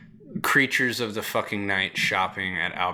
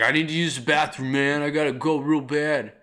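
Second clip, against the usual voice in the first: higher, pleading junkie voice; whiny crackhead plea; 5 semitones higher, 4 dB louder than usual. Retold or imitated. Crackhead begging the night worker for the bathroom, high whiny plea. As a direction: beg like a desperate crackhead.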